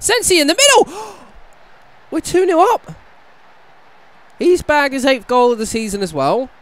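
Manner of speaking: high, loud joyous yell; ecstatic goal shout. Real, not acted. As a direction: scream it like a goal. Scores second goal, gasps.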